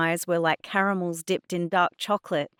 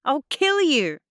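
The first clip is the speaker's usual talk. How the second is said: high-pitched shouted threat; furious shout; 10 semitones higher, 8 dB louder than usual. A narrator's reading. Girl struggling with Adnan, shouting a threat. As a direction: yell it in a rage. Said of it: "I shouted".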